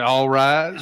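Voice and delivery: loud booming all rise; court announcement. Pretend. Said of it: "when I walk in the room and say".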